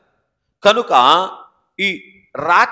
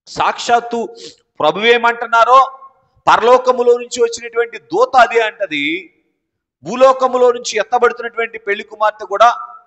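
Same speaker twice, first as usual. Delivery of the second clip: high, loud preaching at full force; fiery preaching; 6 semitones higher, 6 dB louder than usual. Acted. Pastor to congregation, high and loud preaching. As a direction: preach it out to the whole church.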